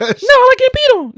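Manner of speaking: very high, loud cartoon girl. [name]'s cartoon confessing, very high and loud. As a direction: squeak it like a cartoon.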